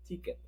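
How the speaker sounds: "ticket" short and soft, unpuffed Spanish t; neutral Spanish pronunciation. Shown as a demonstration. Teacher gives the similar word, soft neutral "ticket". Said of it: "but kind of neutral again".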